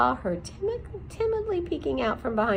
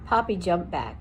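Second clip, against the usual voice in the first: usual speaking voice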